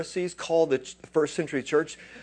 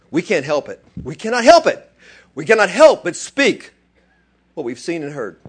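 higher and louder, can't hold it in; passionate defiance; 3 semitones higher, 10 dB louder than usual. Retold or imitated. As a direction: apostles defy the authorities, loud. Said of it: "And they said".

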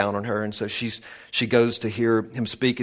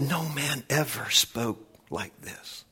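slow, soft and weighty; hushed awe; 7 dB quieter, slower than usual. Acted. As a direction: draw it out, letting the voice sink away. Preacher quotes awed crowd, slowly.

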